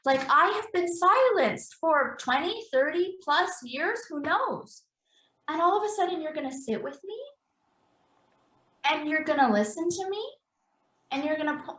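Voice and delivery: a long-silenced body finally speaking. Pretend. Therapist voicing the body, pleading to be heard.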